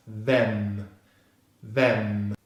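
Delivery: "them" said as "vem", drawn out onto M; V, E, M syllable. Shown as a demonstration. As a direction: say "vem" slowly, with a voiced V. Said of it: "V consonant sound is followed by the single E vowel".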